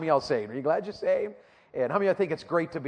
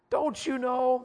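Don't you know said much higher, pressing on you; rising emphatic question; 8 semitones higher, slower than usual. Shown as a demonstration. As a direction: lift it high on you like a challenge. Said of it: "I like to say it like this".

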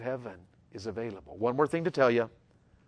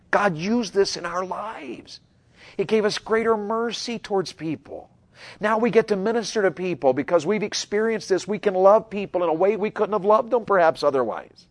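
louder, higher preaching; building sermon; 7 semitones higher, 8 dB louder than usual. Acted. Preacher pressing the point home, louder. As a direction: rise to a climax. Rising to full force.

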